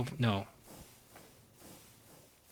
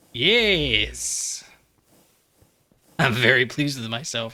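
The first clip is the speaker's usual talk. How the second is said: gloating; 7 dB louder, slower than usual. Real, not acted. Gloating over his machine plan.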